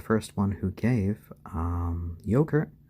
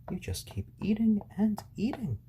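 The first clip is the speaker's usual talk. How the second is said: playful teasing; 12 semitones higher, 3 dB quieter than usual. Real, not acted. Teasing the big chick.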